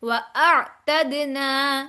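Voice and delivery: व अअतदना slow, with a still ain; drawn-out व अअतदना, no echo after the ain; no bounce on ain. Shown as a demonstration. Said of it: "आइन के ऊपर कलकला नहीं होगा".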